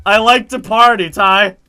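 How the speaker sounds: high, loud mock party cheer; mocking party lover. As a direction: shout it as a hammed-up party animal. Hammed-up repeat of attendant's line.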